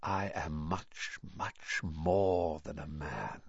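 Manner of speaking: low, slow, hushed menace; barely audible. A narrator's reading. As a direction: draw it out softly and low. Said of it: "barely audible now".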